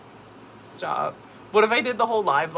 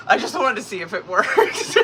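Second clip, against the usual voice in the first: real giggles around the words; laughing; 6 semitones higher, 3 dB louder than usual. Real, not acted. Streamer explaining the voice-effect test, laughing. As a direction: break up laughing as you explain.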